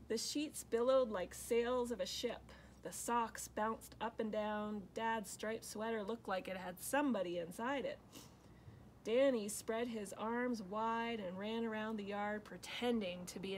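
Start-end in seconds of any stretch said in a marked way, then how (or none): none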